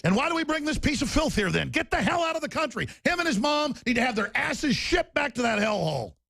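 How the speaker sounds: high, shouted fury; furious shout. Real, not acted. Host raging at the imam on air, high and shouted. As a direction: roar it out in disgust.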